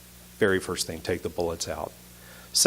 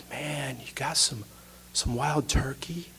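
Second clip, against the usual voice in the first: dazed friend; 4 semitones higher, 10 dB quieter, slower than usual. Retold or imitated. Hypothermic friend asks for whiskey.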